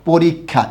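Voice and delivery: পরীক্ষা said with a lengthened kh, like পোরিকখা; crisp teacher demo of পরীক্ষা; doubled kkh. Shown as a demonstration. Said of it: "কী হবে বলুন তো".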